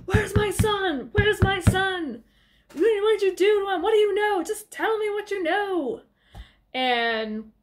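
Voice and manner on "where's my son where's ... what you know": high, insistent mock demand; Daddy Whelan. Angry father banging on the door, mock-frantic demand. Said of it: "Daddy Whelan's banging on the door".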